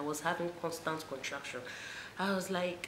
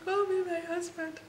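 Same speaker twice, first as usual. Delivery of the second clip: pleading in pain; 14 semitones higher than usual. Retold or imitated. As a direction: beg for the husband like the pain is unbearable. Repeats demand for husband.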